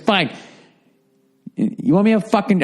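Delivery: high, exasperated snap at her; annoyed retort. Retold or imitated. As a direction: throw it back at her, fed up. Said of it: "I was really annoyed with her".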